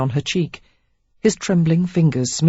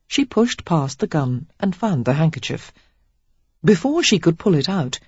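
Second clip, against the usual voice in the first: ordinary delivery, nothing marked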